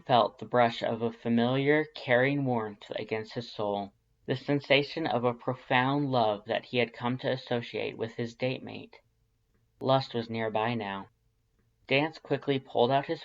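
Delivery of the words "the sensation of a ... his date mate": plain reading voice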